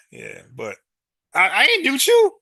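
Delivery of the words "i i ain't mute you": loud protest. Real, not acted. Accused of muting the caller.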